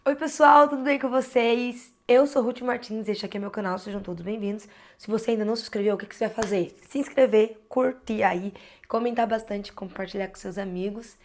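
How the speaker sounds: loud, bright greeting to subscribers; upbeat YouTuber intro. Acted. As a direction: pump up the viewers to subscribe. Energetic channel intro.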